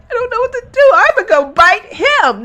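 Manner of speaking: very high helpless mocking voice; mock childish. Mocking the mother who won't act, childish. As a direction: imitate her as childishly helpless. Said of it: "Like, that's just childish".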